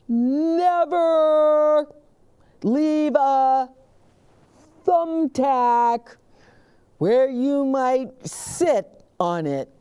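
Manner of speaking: high, loud, slow lecture voice; lecturing officer. Acted. Officer Buckle lectures the students, high and loud. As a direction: drive each word home to the crowd. Buckle's safety tip to audience.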